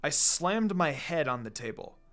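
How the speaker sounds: ordinary delivery, nothing marked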